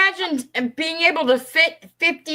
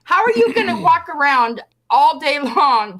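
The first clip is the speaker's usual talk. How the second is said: ordinary talk, nothing marked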